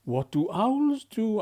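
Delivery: chirpy small Pip asking; little bird voice. Acted. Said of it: "asked Pip".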